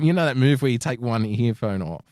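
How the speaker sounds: ordinary delivery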